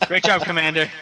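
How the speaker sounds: words through a laugh. Real, not acted. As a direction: say it while still laughing. Still laughing.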